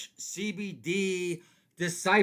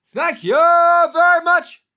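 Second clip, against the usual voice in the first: high, loud and slow; over-the-top comic sign-off; 13 semitones higher, 16 dB louder, slower than usual. Acted. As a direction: belt it like a lounge comic.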